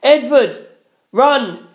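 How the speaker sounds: high urgent yell. A narrator's reading. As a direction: call out a warning to him. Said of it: "I yelled".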